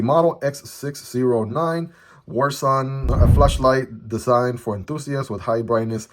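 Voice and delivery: normal talking voice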